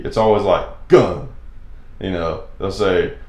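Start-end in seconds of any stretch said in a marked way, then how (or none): 0.9-1.3 "Gun" with a hard, punched G, louder; emphasis on G. Shown as a demonstration. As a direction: spit out "Gun" with a heavy G. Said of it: "They always put emphasis on G".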